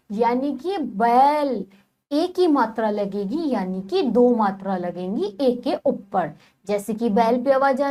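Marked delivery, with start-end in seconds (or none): 0.8-1.7 बैल said slowly with its ai stretched; drawn-out vowel. Shown as a demonstration. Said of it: "तो बैल भी हमने खींच के बोला".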